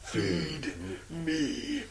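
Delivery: low, very slow groaning demand; mock monster. Co-host playing the big guy, slow low monster moan. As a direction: moan it like a starving beast.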